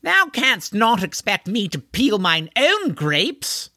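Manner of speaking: high, slow aristocratic outrage; indignant pompous horseman. Acted. Horseman refusing to peel his own grapes, pompously indignant. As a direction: stretch it out, lofty and affronted.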